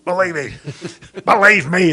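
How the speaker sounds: loud mock presidential "Believe me"; presidential catchphrase. Priest mimicking the president's catchphrase, loud and hammed-up. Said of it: "as the president says".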